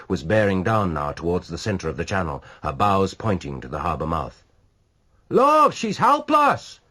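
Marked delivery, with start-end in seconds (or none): 5.3-6.8 high, loud, very slow cry. A narrator's reading. A bystander crying out as the ship drifts, loud. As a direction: wail it out in alarm. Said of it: "cried a voice".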